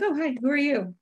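high, sing-song mock greeting; sweet greeting to a tree. Checked-out woman greeting her doodled tree in a high chirp. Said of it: "I'd be like".

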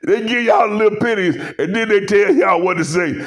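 ordinary delivery

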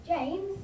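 James pitched high with a rise; tone rising on James. Shown as a demonstration. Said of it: "It'll go".

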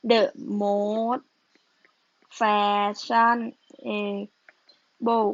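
each syllable stretched and spaced out; slow dictation. Real, not acted. Teacher writing the superlative for the class, slow dictation. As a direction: say it as slowly as you write it.

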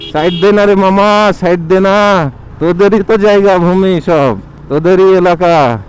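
calling out. Real, not acted. Calling out to driver in traffic.

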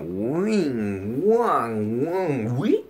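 slow, woozy spinning sound effects; dizzy swooping noises. Retold or imitated. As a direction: swoop up to a high final note. Imitates the dizzy spinning feeling.

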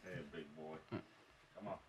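soft sick voice. Real, not acted.

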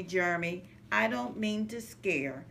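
ordinary delivery, nothing marked

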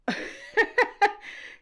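a real burst of airy, high laughter; high breathless laugh. Real, not acted. Narrator cracking up as Craig tells on himself, airy laugh.

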